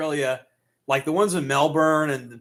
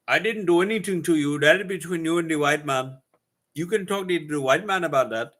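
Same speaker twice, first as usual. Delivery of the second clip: slightly raised mock Indian accent; 4 semitones higher than usual. Streamer as an Indian man denying blame, mock Indian accent. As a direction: protest in a put-on Indian accent. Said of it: "I like doing the Indian voice".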